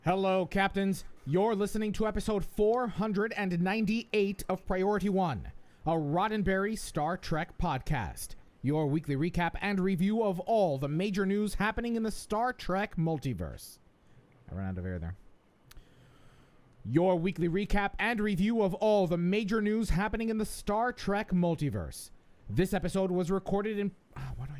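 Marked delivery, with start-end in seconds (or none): 0.0-13.8 polished announcer delivery; lifted, projected host read, drawn-out ending; podcast intro read. Real, not acted. Reads the show's intro. 16.9-23.9 polished announcer delivery; podcast intro read. Real, not acted. Reads the show's intro.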